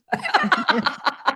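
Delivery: real laugh breaking out; bursting laugh. Real, not acted. Laughs at husband's age.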